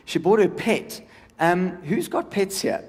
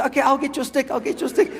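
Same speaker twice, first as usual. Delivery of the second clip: high-pitched dopey willing dog; doof dog; 6 semitones higher than usual. Acted. Comedian as the poor dog fetching for his owner, dopey. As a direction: play a simple, obliging dog. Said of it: "the poor dog is so doof".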